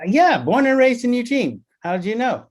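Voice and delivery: high-pitched mock surprise; imitated surprised client. Blushing client asks how he guessed, high imitated surprise. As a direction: play the client's astonished reply, high-pitched.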